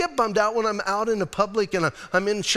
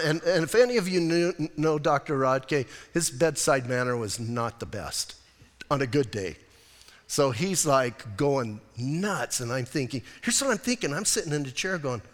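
ordinary delivery

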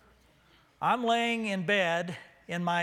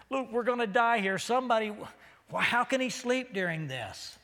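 higher-pitched, agitated outburst; acted panic; 5 semitones higher than usual. Disciple to the others as the boat floods, acted panic. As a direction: play a disciple alarmed and exasperated.

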